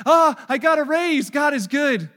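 high, loud hammed-up cheer; loud mocking churchgoer cheer; mock cheerful. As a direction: parody the chipper churchgoer. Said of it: "what I hear too often is like".

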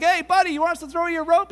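calling down. Acted. Hikers offering a rope to hanging man, calling down. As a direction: call out to someone below you.

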